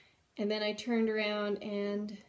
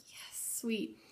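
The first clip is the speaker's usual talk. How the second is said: whispered yes; 7 dB quieter than usual. Retold or imitated. Quiet private joy at positive test.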